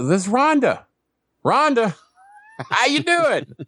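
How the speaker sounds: high, sugary phone voice with a laugh; ex-girlfriend Rhonda. Retold or imitated. Ex Rhonda calling him on the phone, high and sugary. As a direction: play the ex calling sweetly after months. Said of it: "It's Rhonda".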